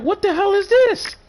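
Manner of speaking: high, squeaky shocked yelp; shocked disbelief. Retold or imitated. Hearing the cheap game price, a high stunned yelp. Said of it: "I'm like".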